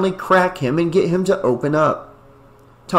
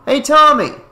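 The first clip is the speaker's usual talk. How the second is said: higher, louder friendly call; kind call; 11 semitones higher, 7 dB louder than usual. A narrator's reading. Matt calls kindly to Tommy, higher and louder. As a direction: call him over kindly. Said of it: "Matt called in a kind voice".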